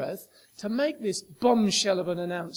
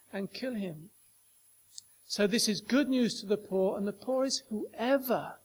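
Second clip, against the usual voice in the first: usual speaking voice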